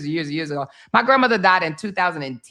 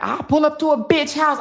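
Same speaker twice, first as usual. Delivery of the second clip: mock threat; 8 semitones higher, 4 dB louder than usual. Sister threatening to fight someone.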